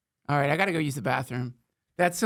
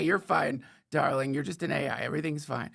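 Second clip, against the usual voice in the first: ordinary delivery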